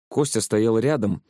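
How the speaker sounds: ordinary delivery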